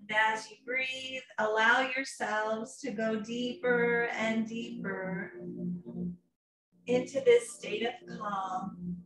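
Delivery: a lightly soothing yoga teacher guiding a meditation; guided meditation. Acted. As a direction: ease the class into calm. Leads a guided relaxation.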